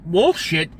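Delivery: ordinary talk, nothing marked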